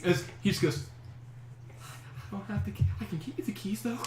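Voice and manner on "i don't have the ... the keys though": high, airy frightened guard; timid plea from a scared captive, pitched up; a little freaked out. Acted. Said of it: "a little freaked out".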